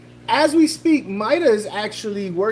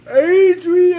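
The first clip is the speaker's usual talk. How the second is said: loud, higher, anguished bellow of the name; Rocky shout; 6 semitones higher, 5 dB louder than usual. Retold or imitated. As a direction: yell it like Rocky calling for her. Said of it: "you mean like in Rocky?".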